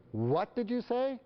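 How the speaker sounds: high, drawn-out indignant question; hammed-up outrage, slow and high; outraged parent. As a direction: play a parent appalled by backtalk. Parent outraged at child's backtalk.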